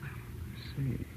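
whispered aside. Acted. Detective quietly probing a clerk.

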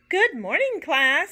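high, loud teacher greeting; teacher answering the class brightly; cheery greeting. A narrator's reading. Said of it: "said Missus Toggle".

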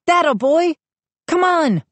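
high, eager coaxing; pleading. A narrator's reading. Brother coaxing dog through the window, pleading. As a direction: beg the dog along with encouragement. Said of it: "I pleaded".

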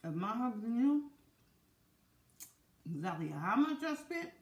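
chewing while talking; speaking between bites; mouth full of corn. Real, not acted. Seen 1: Bites corn at -3 and +2.5, talks with mouth working between bites.

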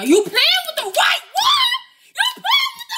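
high and loud, stretched; shout. Real, not acted. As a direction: call her out at full voice. Yelling at girl.

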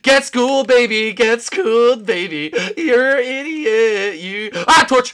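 high, loud gloating jeer; loud trash talk; loud taunting. Real, not acted. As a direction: crow over a beaten rival. Taunting opponent in game.

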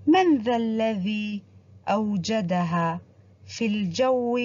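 slow, measured recitation of the verses; expressive poem reading. Acted. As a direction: read the poem aloud with feeling for children. Reads the poem aloud to pupils.

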